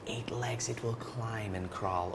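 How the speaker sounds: whispered aside; hushed. Shown as a demonstration. Teacher describing spider to kids.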